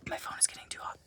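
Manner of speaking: unvoiced quick whisper to herself; whispered aside. Real, not acted. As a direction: murmur it off to the side, breath only. Aside about hot phone.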